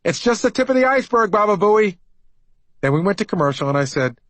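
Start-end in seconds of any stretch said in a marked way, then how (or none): 0.0-2.0 high, loud gleeful yell. Retold or imitated. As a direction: shout it after him across the studio. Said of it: "Then he yelled".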